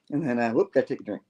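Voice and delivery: ordinary delivery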